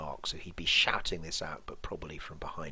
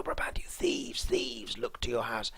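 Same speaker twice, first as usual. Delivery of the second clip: alarm shout; 5 semitones higher than usual. Acted. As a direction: raise the alarm at the house. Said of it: "if you were shouting out fire".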